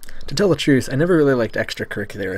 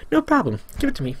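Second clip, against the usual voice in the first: put-on girl voice; 14 semitones higher than usual.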